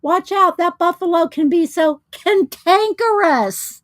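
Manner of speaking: high, hammed-up cartoon voice; excitable little critter calling out a warning; cartoon character warning. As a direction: sound a mock alarm, leaning into the big word. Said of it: "And then this one's going".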